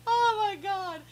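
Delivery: high, laughed-out exclamation; laughing. Real, not acted. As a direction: gasp it out after laughing hard. Recovering from a laughing fit.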